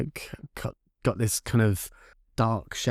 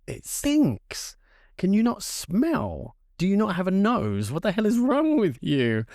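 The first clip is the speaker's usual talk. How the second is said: exasperated outrage; 8 semitones higher than usual. Retold or imitated. Telling friend he can't smell.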